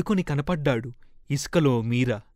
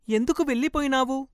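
put-on child's plaintive question; 8 semitones higher, faster than usual. Meera's voice asks again.

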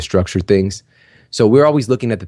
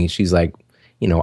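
normal talking voice

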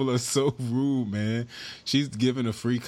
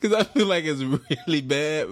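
ordinary talk, nothing marked

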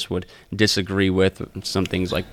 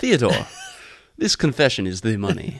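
bad English accent; 3 semitones higher than usual. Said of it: "that was my best English accent".

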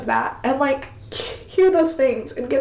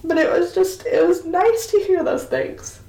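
tearful; 5 semitones higher than usual. Real, not acted. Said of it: "Sorry, let me collect myself".

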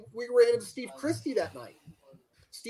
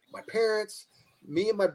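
ordinary delivery

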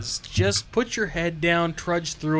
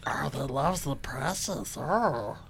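Gollum-like creature voice; 2 semitones lower, 6 dB quieter, slower than usual. Acted.

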